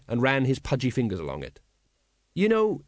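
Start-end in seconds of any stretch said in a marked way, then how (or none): none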